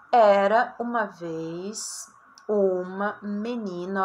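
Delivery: each word drawn out slowly like a dictation; slow reading aloud. Shown as a demonstration. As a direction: read it very slowly for the class. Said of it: "reads model sentence". (leitura bem lenta)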